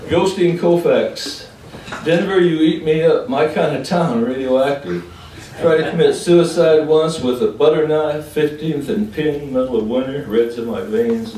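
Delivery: loud, high opening, gradually easing; poem declamation. Acted. Poet addressing Denver, forceful declamation. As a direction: declaim to the room.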